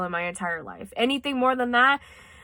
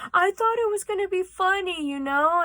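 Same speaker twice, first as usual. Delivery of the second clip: mocking the boy; 9 semitones higher than usual. Boy's excuse voiced in retelling.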